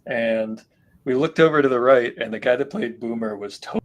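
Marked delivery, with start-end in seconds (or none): none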